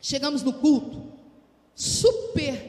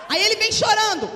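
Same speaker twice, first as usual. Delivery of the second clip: belts it out, much higher and louder; preaching shout; 9 semitones higher, 9 dB louder than usual. Acted. Preacher shouting the line to the congregation. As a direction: shout it over the whole church.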